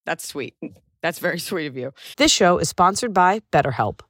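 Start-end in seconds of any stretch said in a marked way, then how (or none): 2.2-4.0 ad read. Acted. Host reading BetterHelp ad to listeners, upbeat ad read. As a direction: sell it like a host ad spot. Reads a sponsor ad.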